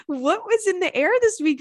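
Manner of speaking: high, laughing question; real laughing disbelief. Real, not acted. Laughing at the week's emails.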